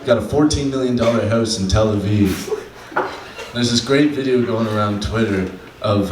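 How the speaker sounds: usual speaking voice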